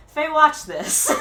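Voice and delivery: loud, slow, insistent call; retold urging. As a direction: reenact calling someone over to look. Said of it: "and I was like".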